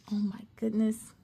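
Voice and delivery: sharp gasp, then quiet worry; gasp then hushed dismay. Acted. Narrator to listeners as Cassie is caught, startled. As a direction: catch your breath in alarm.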